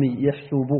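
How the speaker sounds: يحسب said yaḥsub with a u vowel on the s; with damma. Shown as a demonstration. Teacher explaining counting sense, يحسب pronounced with damma: yaḥsub. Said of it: "ومضارعه بالضم".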